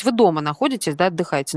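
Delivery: usual speaking voice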